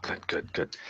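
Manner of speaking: breathless whispered good good good; whispered approval. Acted. Wari approving Krim's wink, whispered. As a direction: barely voice it, just air.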